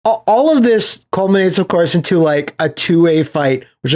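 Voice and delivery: ordinary talk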